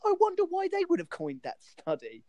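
sarcastic high-pitched "I wonder why". Retold or imitated. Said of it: "You're like".

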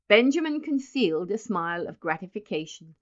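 plain reading voice

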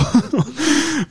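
éclat de rire aigu. Real, not acted. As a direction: rire franchement de l'accent. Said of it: "tu rigoles, je t'entends". (rire aigu après le nom du festival)

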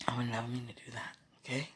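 soft sincere whisper. Real, not acted. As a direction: reassure him under your breath. Whispered reassurance.

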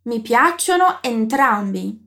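slow, clear classroom model; slow model sentence. Shown as a demonstration. Teacher models Italian for her student, slowly. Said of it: "fala pra mim como você diz".

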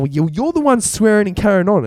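hammed-up scolding neighbour; mock Karen scold. The Karen next door scolding Martin for swearing.

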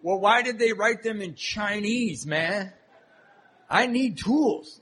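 slow bewildered whine. Retold or imitated. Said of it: "And I thought".

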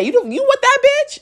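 very high, squawking mock accusation; retold cussing out. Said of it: "getting cussed out every day".

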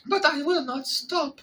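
put-on high French-accented voice; mock French lady, high pitch. Friend continues Fleur voice.

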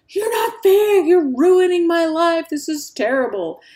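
high, loud mock outburst of an outraged kid; acted child protest. Kid protesting mom's new chore charts, high indignant mock yell. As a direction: wail it out like a kid who's been wronged.

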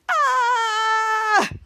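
long, high, loud scream; imitated scream. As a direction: hold out a mock scream. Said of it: "cut to them screaming".

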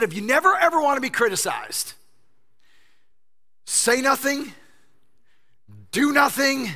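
rising to a loud shout; building shout. Acted. Preacher building the Aristotle quote to a shout.

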